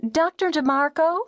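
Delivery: high, tentative woman caller; timid caller pitched high; hesitant radio caller. A narrator's reading. Nervous caller to radio show.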